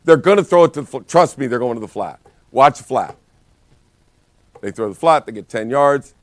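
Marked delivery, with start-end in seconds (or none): 2.5-3.2 louder shouted warning from the sideline; coach's yell. Retold or imitated. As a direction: bark the warning at the linebacker. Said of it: "I yell at the outside linebacker".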